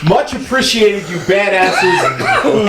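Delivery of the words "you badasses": ordinary delivery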